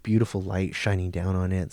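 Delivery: ordinary delivery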